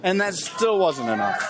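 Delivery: excited, shouting play-by-play; wrestling commentator. Acted. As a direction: call the match live, yelling. Live play-by-play.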